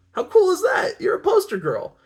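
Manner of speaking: high, loud, gushing delight; excited. A narrator's reading. Photographer thrilled for his friend, excited and high-pitched. Jose excitedly congratulates Ana.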